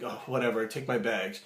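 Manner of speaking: mock snooty brush-off; uppity guest. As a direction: dismiss him like a snob. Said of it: "they're very uppity and like".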